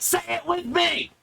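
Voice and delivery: very high, strained scream; screamed prompt. Shown as a demonstration. Presenter screaming at viewers to repeat, very high and strained. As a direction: scream at them to repeat after you. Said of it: "When I screamed at you like a dick".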